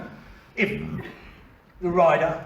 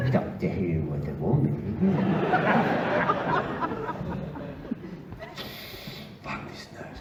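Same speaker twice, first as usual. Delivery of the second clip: ordinary talk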